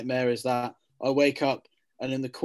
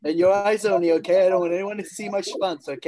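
higher, louder whiny Trump voice; Trump impression; 5 semitones higher, 7 dB louder than usual. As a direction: whine like Donald Trump.

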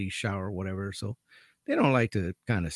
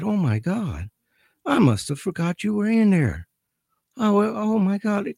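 higher, louder fake oh-my-God; mock surprise; 11 semitones higher, 7 dB louder than usual. Officer feigning surprise to the inmate, hammed-up mock apology. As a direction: play innocent, overdone.

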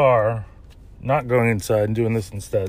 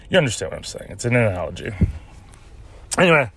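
usual speaking voice

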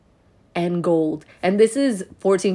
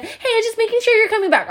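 put-on squeaky high phone voice of the store girl; high-pitched voice of the mall employee on the phone; high-pitched sales girl; 13 semitones higher, faster than usual. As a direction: mimic the sweet high voice of the saleswoman. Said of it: "with, like, a high-pitched voice".

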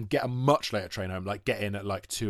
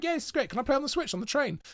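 put-on much higher childlike voice, happy; imitating his son. Dad voicing his happy young son, high and chirpy. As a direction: chirp it like a carefree six-year-old. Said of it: "He was like".

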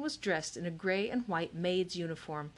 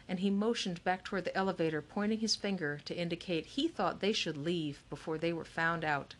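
plain reading voice

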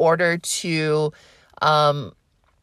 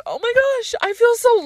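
much higher, gushing oh my gosh; touched and gushing; 4 dB louder than usual. Retold or imitated. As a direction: squeal it out, overwhelmed with being loved. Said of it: "it was like".

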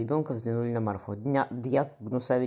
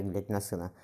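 ordinary delivery, nothing marked

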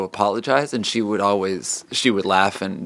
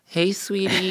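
put-on high-pitched, babyish sweet-talk; put-on little-kid voice; 8 semitones higher, slower than usual. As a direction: talk like a tiny child to a partner. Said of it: "If I talked in a baby voice".

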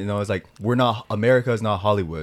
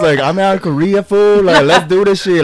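put-on high, loud East LA Mexican accent; 9 semitones higher, 12 dB louder than usual. Friend recalls greeting people in his East LA Mexican accent. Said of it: "that East L A accent".

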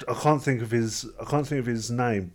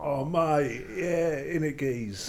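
lightly put-on chav slang voice; chav impression; 2 semitones higher, much slower than usual. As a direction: join in the chav mockery. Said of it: "sounds like a chav".

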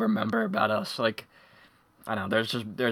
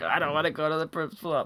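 slightly higher, whiny imitation of a crying kid; mock crying; 4 semitones higher than usual. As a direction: imitate a tearful kid protesting. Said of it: "like, crying. He's like".

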